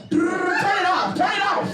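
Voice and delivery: high, slow yelled plea at the fan; mock panicked shout. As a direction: yell to stop the fan, hammed-up. Legs hitting ceiling fan.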